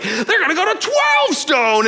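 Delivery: high-pitched, exaggerated freak-out; hammed-up panicky rant, voice flying up; mock ranting. Venting to wife about losing the kids, mock rant. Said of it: "I would rant and rail and vent".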